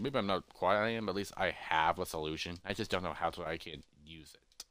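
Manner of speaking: low, soft muttering to oneself; quiet self-talk, fading out; thinking aloud. Acted. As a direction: mutter it, sinking away to almost nothing. Character thinks aloud, trailing down.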